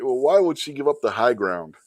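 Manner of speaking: normal talking voice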